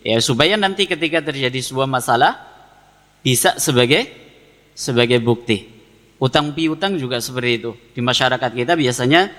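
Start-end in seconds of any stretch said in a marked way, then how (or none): none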